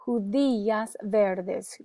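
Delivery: "Judías verdes" said slowly for repeating; slow, measured "Judías verdes", each word distinct; careful pronunciation model. Shown as a demonstration. Teacher gives students a word to repeat, slow careful model. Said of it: "You can repeat for pronunciation".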